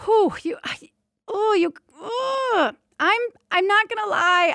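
real tears, voice breaking high on "whew" and "oh"; fighting back tears and losing the words; choked up. Real, not acted. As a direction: sighs and broken words from real crying. Breaks down recalling the birth.

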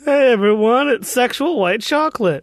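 high, loud hype-man introduction; mock stage announcer. Teasing Roy about his stage name, high announcer call.

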